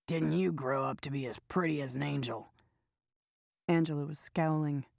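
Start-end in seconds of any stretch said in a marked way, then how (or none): 0.1-2.5 put-on raspy old man. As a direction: sweet-talk her like a creepy old drifter. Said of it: "he drawled in a raspy voice".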